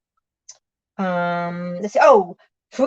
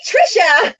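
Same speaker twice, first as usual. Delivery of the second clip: excited greeting; 14 semitones higher, 7 dB louder, slower than usual. Real, not acted. Greeting a viewer in live chat.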